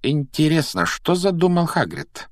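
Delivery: high, lightly intrigued question; curious wondering. A narrator's reading. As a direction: muse aloud with curiosity, pitch lifted. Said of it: "с любопытством произнесла Гермиона".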